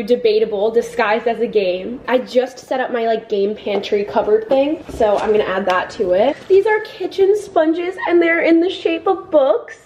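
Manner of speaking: ordinary talk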